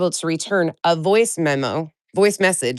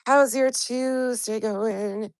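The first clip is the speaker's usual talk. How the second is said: slow drawn-out greeting; 5 semitones higher, 3 dB quieter, much slower than usual. Shown as a demonstration. Streamer re-asking her chat, slow and drawn out. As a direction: stretch each word out slowly, pitched up. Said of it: "repeats the greeting slowly".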